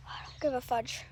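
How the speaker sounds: sneaky whispered rebellion; whispered aside. Real, not acted. Kid whispering defiance about her mom's rule. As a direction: whisper it like the mom might hear.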